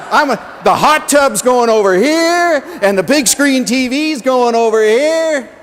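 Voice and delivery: loud, high, giddy shout; a mom thrilled to claim her son's room; gleeful planning. Retold or imitated. Mom measuring her son's room, loud and giddy. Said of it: "She said".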